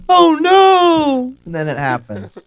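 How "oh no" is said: high, loud mock cry of dismay; mock wail. Host mimicking Charlotte's repeated alarm, mock wail. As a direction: wail it out, hammed-up. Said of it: "Charlotte goes".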